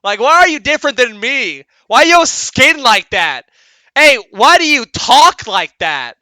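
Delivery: put-on loud, high, blunt child voice firing questions; a parent imitating their child's loud innocent questions; nosy kid. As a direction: play a curious kid blurting rude questions out in public. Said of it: "your kid's like".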